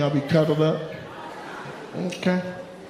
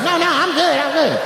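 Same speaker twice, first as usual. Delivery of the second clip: much higher, mimicking her; imitated coy woman; 10 semitones higher than usual. Comic mimics woman hiding her missing tooth, high-pitched. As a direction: put on a high voice, waving off attention. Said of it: "She gonna show me the good side".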